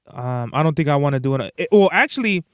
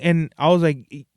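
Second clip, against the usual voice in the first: ordinary talk, nothing marked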